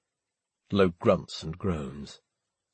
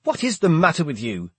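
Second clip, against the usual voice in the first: higher, loud angry demand; 7 semitones higher, 3 dB louder than usual. A narrator's reading. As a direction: demand it sharply. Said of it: "jump up and demand".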